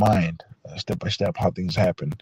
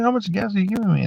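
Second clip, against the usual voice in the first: anxious question; 10 semitones higher, faster than usual. Retold or imitated. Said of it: "I'm like".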